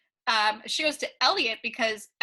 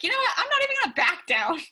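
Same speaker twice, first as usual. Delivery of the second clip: much higher, louder, defiant put-on voice; imitating Rue's defiance, pitched up high; 9 semitones higher, 4 dB louder, faster than usual. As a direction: throw it back at her, refusing to back down. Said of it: "Rue then turns and she's like".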